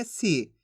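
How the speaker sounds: low, soft, brief "सी"; "सी" kept short as a reading model; short i sound. Shown as a demonstration. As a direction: say "सी" with a short falling vowel. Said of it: "इसके उच्चारण में कम समय लगेगा".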